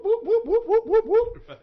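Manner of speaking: very high, rising siren whoops; imitated police siren. Telling how police caught them pulling out, high siren whoops. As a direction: whoop like a squad car siren.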